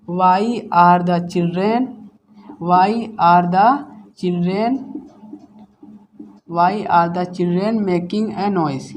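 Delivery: put-on why are the children making a noise spoken slowly, with pauses between words; slow dictation. Shown as a demonstration. Teacher reading the question aloud for students to copy, very slow. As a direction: spell it out slowly for the class. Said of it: "teacher dictating the English sentence".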